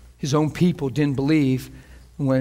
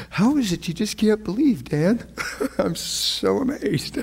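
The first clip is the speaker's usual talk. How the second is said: higher, laughing disbelief; mock amazement; 7 semitones higher than usual. Jesus asking Dan about unbelief, mock amazement. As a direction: marvel in mock bafflement, stretching the end. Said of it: "be on that side of marveling".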